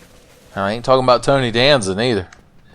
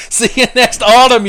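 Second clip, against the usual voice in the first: higher, louder gleeful taunt; gloating taunt; 13 semitones higher, 7 dB louder than usual. Real, not acted. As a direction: shout it as a victory jeer. Taunting as he kills Lautrec.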